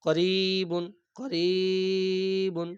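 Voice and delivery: slow, stretched Quranic chant of করিবুন; long, sung-out করিবুন; drawn-out recitation. Shown as a demonstration. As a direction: draw out করিবুন like tilawat.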